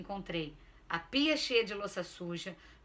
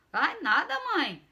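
put-on awkward teen; 7 semitones higher, 6 dB louder than usual. Teen son hovering at door.

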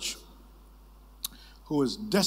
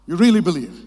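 loud challenge; 12 dB louder than usual. Retold or imitated. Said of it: "I said".

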